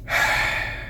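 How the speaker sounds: soft breathy sigh; a sad sigh after the confession; weary sigh. Real, not acted. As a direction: breathe out the hurt.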